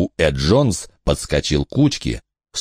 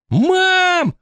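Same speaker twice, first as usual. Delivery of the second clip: put-on boy's shout. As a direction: yell out. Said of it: "крикнул он в последний раз".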